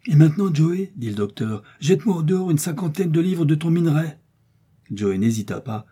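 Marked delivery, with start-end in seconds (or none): 0.1-0.9 urgent order. A narrator's reading. Doctor orders Joey during the storm. 1.8-4.2 urgent order. A narrator's reading. Doctor orders ballast thrown out.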